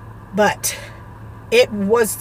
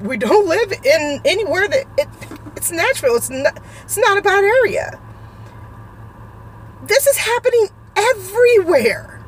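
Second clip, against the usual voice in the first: real high-pitched, near-crying anguish; tearful distress; 8 semitones higher than usual. Real, not acted. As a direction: plead helplessly, voice pushed high with tears. Upset about violence near her children.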